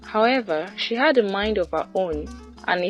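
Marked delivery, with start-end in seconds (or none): none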